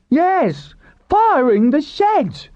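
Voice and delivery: very high, drawn-out outcry; indignant cry. A narrator's reading. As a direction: echo the accusation as a high cry. Another in crowd cries accusation.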